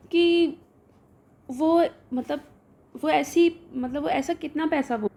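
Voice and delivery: usual speaking voice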